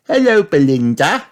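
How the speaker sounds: put-on high little girl voice; cheerful friendly hello; Peppa's greeting. A narrator's reading. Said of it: "said Peppa".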